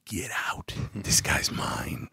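hushed, deep, slowing command; low menacing order. Retold or imitated. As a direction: claim him in a low, quiet voice. First order to detectives, low.

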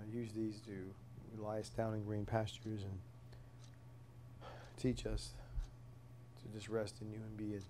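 earnest spoken prayer, soft and halting. Real, not acted. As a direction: pray it quietly, pausing between names. Closing prayer before Bible study.

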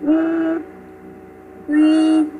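Uy with lips pursed as if whistling; rounded Uy. Shown as a demonstration. Teacher models ü as Uy, lips held round. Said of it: "giữ nguyên một chút nữa".

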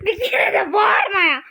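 loud, drawn-out scolding; exasperated yelling. Acted. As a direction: complain loudly, fed up with the noise. Complains about the constant screaming.

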